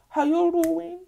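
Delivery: high-pitched sing-out greeting; playful high hello; flirty greeting act. Acted. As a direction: coo it high. Acting out greeting with sauce.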